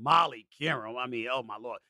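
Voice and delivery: normal talking voice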